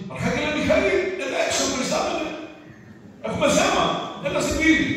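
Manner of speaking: the angel calls the archangel Michael to the gate, calling out high. Acted. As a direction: shout up for the archangel to come. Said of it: "Φωνάζει".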